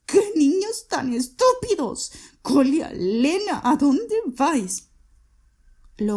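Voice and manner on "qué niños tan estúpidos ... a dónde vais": mucho más agudo, llamando; grito desesperado. A narrator's reading. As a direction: grita tras los niños que huyen. Grita a los niños que huyen. (Catalina grita desesperada a los niños que escapan)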